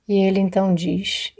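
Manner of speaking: ordinary delivery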